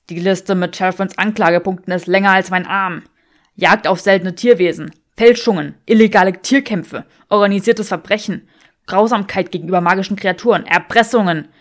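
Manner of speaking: loud, hard listing of charges; furious man reading out the charges; angry Draco. Acted. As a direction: rattle off the crimes in a loud rage. Draco lists charges in argument.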